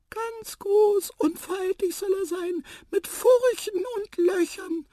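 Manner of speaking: very high, slow, loud squeak; squeaky mouse. A narrator's reading. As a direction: describe it in stretched mouse squeaks. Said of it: "piepste Tiputip". (gedehnt piepsende Maus)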